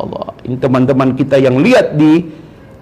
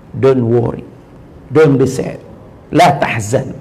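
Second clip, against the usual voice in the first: normal talking voice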